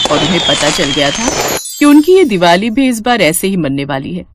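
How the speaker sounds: plain reading voice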